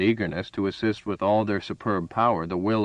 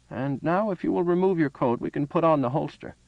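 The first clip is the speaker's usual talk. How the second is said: put-on higher, courteous old man's voice; old shopkeeper; 7 semitones higher than usual. A narrator's reading. Old man asking Farah to remove his coat, higher voiced. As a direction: politely direct the next step.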